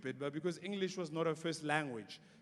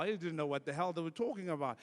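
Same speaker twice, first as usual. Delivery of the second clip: usual speaking voice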